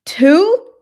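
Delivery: sharp high disbelieving question; surprised. Real, not acted. Streamer reacting to only two tang yuan, surprised.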